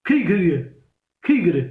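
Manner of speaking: Udaipur-side lilt, falling at the end; Mewari accent. Shown as a demonstration. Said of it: "Udaipur की तरफ". (मेवाड़ी लहजे में)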